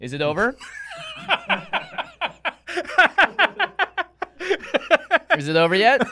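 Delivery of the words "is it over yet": high helpless laughing; pained laughter. Real, not acted. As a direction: laugh through the burning pain. Laughing through hot sauce pain.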